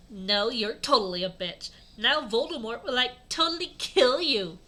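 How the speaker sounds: ordinary delivery, nothing marked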